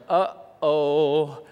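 lightly voiced regretful lawyer; a touch of uh-oh regret; worried lawyer. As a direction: mutedly play a man realizing his mistake. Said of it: "if the lawyer's going".

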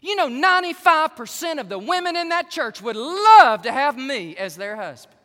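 shouted high brag; boastful shout. Retold or imitated. Pastor bragging to his wife, loud shout. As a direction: crow it at the top of your voice.

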